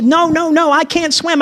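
put-on high, pleading child voice refusing; a scared kid begging not to jump; high panicky child saying no; frightened little boy.

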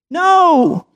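put-on high, loud child's no; a scared kid flatly refusing; high yelped refusal; frightened little boy.

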